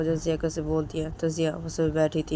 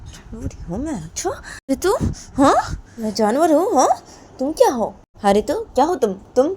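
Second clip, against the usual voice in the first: higher and louder, demanding; angry challenge; 9 semitones higher, 6 dB louder than usual. Acted. Ritu confronting Jiya, higher louder angry demand. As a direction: throw the question back defiantly.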